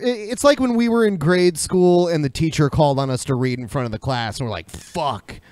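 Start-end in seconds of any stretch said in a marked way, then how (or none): none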